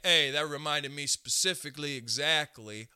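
lightly voiced Italian-American comic; Sebastian Maniscalco impression. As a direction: mimic Sebastian Maniscalco's exaggerated phrasing. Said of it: "none of my friends sound like this".

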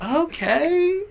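high, drawn-out mimicked okay; impressed girls. Girls at the party warming to the rapper, mock impressed. Girls won over in five seconds.

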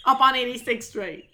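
put-on loud New York accent; brash New York street voice. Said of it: "I brought out my New York accent".

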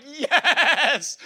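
high, loud thrilled yell; overjoyed buyer; excited shout. Retold or imitated. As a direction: cheer like you just won. Excited buyer hearing the offer.

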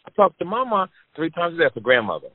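put-on high, matter-of-fact girl voice; young daughter's voice. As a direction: explain it like a kid who thinks it's obvious. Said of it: "She said".

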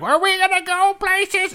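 very high, loud drawn-out cry; slow, exaggerated high-pitched demand; mock impatient passenger. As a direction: imitate a passenger calling to the driver. Imitated impatient passenger shouting to driver.

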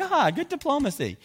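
very high mock praise; sarcastic quip. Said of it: "You're like".

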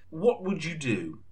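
normal talking voice

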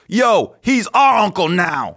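slow shouted bro voice reading a text; mock text voice. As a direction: read the text like a booming dude. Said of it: "Is that my voice?".

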